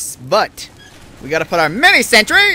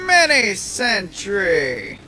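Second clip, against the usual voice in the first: slow drawn-out call; much slower than usual. Acted.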